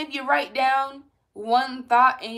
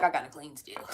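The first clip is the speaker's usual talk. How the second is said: lower, softer anxious mumble; mock anxious cleaner; 8 semitones lower, 7 dB quieter than usual. Viewers fretting about cleaning, low and soft. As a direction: fret like a compulsive cleaner. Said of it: "Y'all be like".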